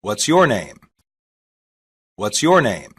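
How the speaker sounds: ordinary talk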